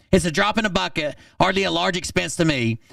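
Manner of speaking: quick mocking reread. Re-reading his text, mocking.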